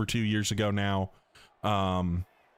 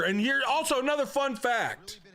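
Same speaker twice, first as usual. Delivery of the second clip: excited announcement. Real, not acted. Announcing a fun fact.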